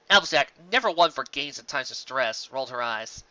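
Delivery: ordinary delivery, nothing marked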